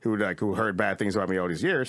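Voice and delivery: normal talking voice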